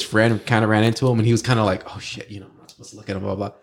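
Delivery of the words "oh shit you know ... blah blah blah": very soft, under-the-breath retold worry; nervous aside. Brother's friend running into Kobe, hushed nervous aside. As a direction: drop right down as if trying not to be noticed. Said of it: "he was kinda all nervous".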